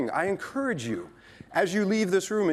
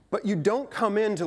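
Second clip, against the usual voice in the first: ordinary delivery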